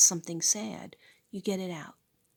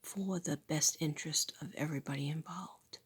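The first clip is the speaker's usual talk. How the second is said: normal talking voice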